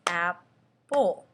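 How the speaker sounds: apple said slowly, clapped in two beats; teacher sounding out apple for kids; slow clapped syllables. Shown as a demonstration. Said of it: "So we go".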